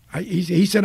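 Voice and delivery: ordinary delivery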